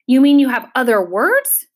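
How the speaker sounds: gleeful bird, rising at the end; happy cry. A narrator's reading. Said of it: "cried the bird happily".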